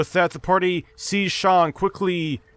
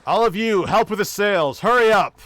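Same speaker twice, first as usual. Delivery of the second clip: higher, raised captain's order; yelling captain; 5 semitones higher than usual. Acted. Captain hurrying the crew to the sails, raised voice. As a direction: call out urgently to the whole crew. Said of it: "the loud yelling voice of the captain".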